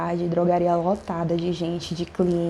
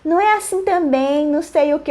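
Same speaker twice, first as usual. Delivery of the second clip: very high mock protest; agitated man imitation; 9 semitones higher, 3 dB louder than usual. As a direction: mimic a worked-up man objecting. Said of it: "você vive alterado".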